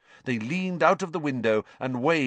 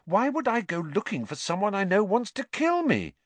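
put-on high, puzzled boy's question; high-voiced incomprehension; blank bewilderment; 12 semitones higher than usual. A narrator's reading. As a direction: ask it baffled, pitched well up. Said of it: "said Harry blankly".

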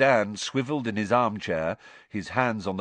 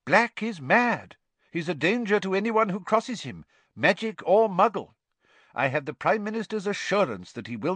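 blustering minister; 9 semitones higher, 4 dB louder than usual. A narrator's reading. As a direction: insist on it like an official.

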